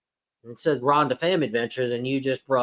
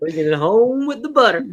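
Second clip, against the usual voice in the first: high, louder mock slogan delivery; playful slogan; 10 semitones higher, 6 dB louder than usual. Host hamming up a made-up channel name, playful slogan. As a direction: sing out the silly title, high and loud.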